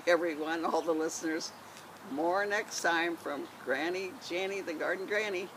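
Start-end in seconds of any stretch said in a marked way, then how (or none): none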